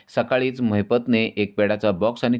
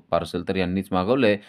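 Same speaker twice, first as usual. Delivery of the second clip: usual speaking voice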